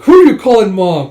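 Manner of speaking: loud, high protest; indignant retort. Retold or imitated. Yun objecting to being called Mom, loud indignant retort. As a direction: fire back in mock outrage.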